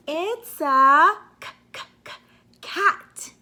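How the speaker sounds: broken c- c- then a high cat; sound it out. Shown as a demonstration. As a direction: tease the first sound before cat. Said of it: "stuttering first sound c".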